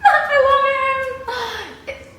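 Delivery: very high, long drawn-out gushing squeal; fangirl squeal. Real, not acted. Fan gushing to viewers about favourite singer, squealing adoration. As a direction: squeal it out slowly, stretched and high-pitched.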